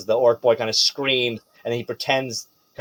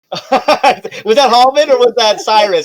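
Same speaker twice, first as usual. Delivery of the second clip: laughing question; 10 semitones higher, 8 dB louder than usual. Real, not acted. Laughing, joking question to players.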